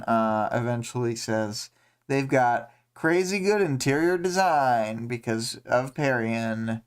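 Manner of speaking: ordinary talk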